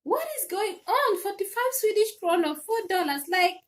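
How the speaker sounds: high, exasperated shouting at the refund; mad outburst. Retold or imitated. Telling Amazon off over the tiny refund, high and exasperated. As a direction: demand to know what is going on, fed up. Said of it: "I was so mad".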